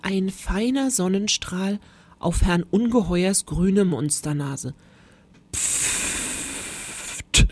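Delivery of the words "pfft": long hiss of escaping air, popped off at the end; deflating balloon noise. A narrator's reading. Monster shrinks in sunlight, long deflating hiss. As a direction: blow out air like a balloon emptying. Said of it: "Als ließe man die Luft aus einem großen Ballon".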